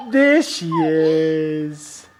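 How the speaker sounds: slow laughing reveal, stretched out; there she is drawn out long and laughing; drawn-out peekaboo. Real, not acted.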